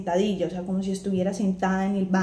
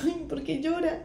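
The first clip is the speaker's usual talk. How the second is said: higher-pitched retold plea; lightly acted, higher, puzzled protest; mock dismay; 6 semitones higher, 5 dB quieter than usual. Daughter to her crying mother, mock dismay.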